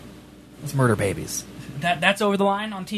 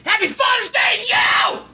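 yelled. Real, not acted. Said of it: "I feel like I had to yell it back".

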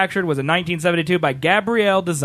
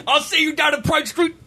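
mock British shout; 6 semitones higher than usual.

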